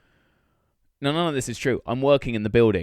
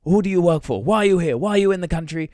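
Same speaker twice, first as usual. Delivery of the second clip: aggressive interrogation; 6 semitones higher, 5 dB louder than usual. Retold or imitated. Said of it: "in their accent as well".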